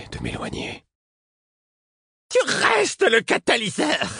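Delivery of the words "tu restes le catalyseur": very high, slow desperate cry; shrieking high voice calling after him. A narrator's reading. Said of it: "d'une voix suraiguë".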